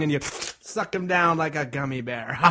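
usual speaking voice

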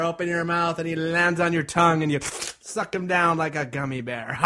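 ordinary delivery, nothing marked

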